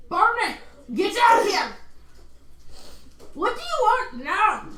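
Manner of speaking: put-on a blacksmith yelling at his assistant in a failed Scottish accent; a bellowing Scotsman, hammed up; attempted accent. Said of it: "I can't do this accent".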